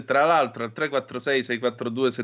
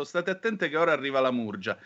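usual speaking voice

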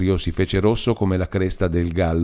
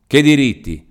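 sharp retort; 6 semitones higher, 6 dB louder than usual. Acted. Demetrio snaps at old man.

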